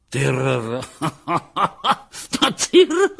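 captain laughing over the word, pitch shooting up; breathless laughter. A narrator's reading. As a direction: crack up laughing, barely catching breath. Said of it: "Kapitán se smál, sotva dechu popadal". (zadýchaný smích)

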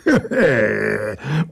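drawn-out throaty chuckle; creature's chuckle. A narrator's reading. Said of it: "Ozval se znovu hlas".

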